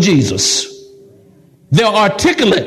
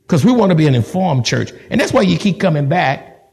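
ordinary delivery

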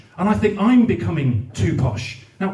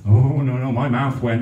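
ordinary delivery

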